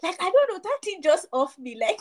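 normal talking voice

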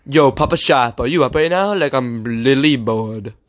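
lisping kid impression, slowing at the end; Muzz. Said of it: "That voice is fucking".

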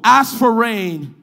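loud, drawn-out scripture proclamation; leading congregational reading. Real, not acted. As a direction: proclaim the verse for all to join. Leads congregation reading aloud.

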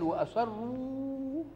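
وأسروا stretched very slowly, rising high; drawn-out stress. Shown as a demonstration. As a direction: hold وأسروا long with a lift. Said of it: "إنما هو قال". (مد كلمة وأسروا بنبرة صاعدة)

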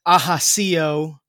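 Ajaccio said slowly, in a Spanish way; Spanish-style pronunciation. Real, not acted. Telling Napoleon's birthplace, Ajaccio said the Spanish way. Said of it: "pronounce that in a Spanish way".

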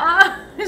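short squealing screams of delight; high fan squeal of joy; excited scream. Real, not acted. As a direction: shriek with fangirl emotion. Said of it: "me la pasé gritando porque me emociono".

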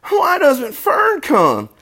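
high, slow, loud piglet wail; drawn-out, high acted wail; tearful young pig. A narrator's reading. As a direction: plead like a little pig about to cry. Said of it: "He began to cry".

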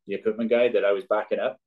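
ordinary delivery

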